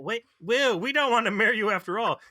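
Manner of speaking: high, hurried backing out; alarmed mock refusal. Host as the would-be wives rejecting Harry, high and hurried. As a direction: blurt it up high, calling the whole thing off.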